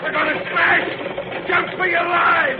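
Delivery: high slowed shout; panicked shout. Acted. Henchman warning all to jump before the crash, panicked shout.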